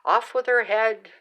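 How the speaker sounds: loud, commanding mock royal order; mean Red Queen. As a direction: bark it like a nasty queen. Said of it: "the old mean Red Queen".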